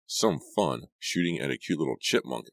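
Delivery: ordinary delivery, nothing marked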